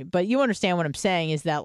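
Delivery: usual speaking voice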